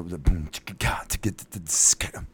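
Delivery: soft whispered chicka beat; hushed beatbox. Retold or imitated. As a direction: breathe the beat out. Beatboxing a track.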